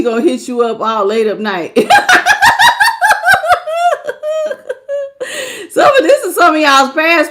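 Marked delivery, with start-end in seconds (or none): 1.8-5.7 high, breaking laugh that tips into a cry; long shrieking laugh. Real, not acted. Reader cracking up at viewers' exes, high helpless laugh. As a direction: cackle helplessly at the drama.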